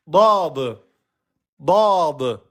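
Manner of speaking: heavy back-mouth dad; emphatic letter. Shown as a demonstration. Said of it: "c'est la lettre".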